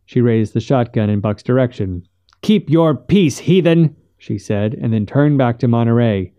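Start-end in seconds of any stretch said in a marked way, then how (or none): 2.4-3.9 higher, slow, measured scolding; stern rebuke. A narrator's reading. Missus Finch aiming the shotgun at Buck, stern rebuke. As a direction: put Buck in his place, pitched up.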